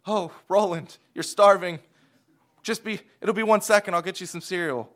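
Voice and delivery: a playful dad calming his starving son; dad reassuring his five-year-old he'll get cereal; soothing dad. Retold or imitated. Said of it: "To which I then".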